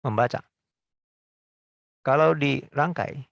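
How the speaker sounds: usual speaking voice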